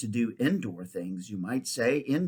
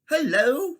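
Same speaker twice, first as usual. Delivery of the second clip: put-on high, eager girl's greeting; young Polly, much higher; bright girl's hello; 13 semitones higher, 7 dB louder than usual. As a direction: call up to the boy, curious. Girl greets strange boy.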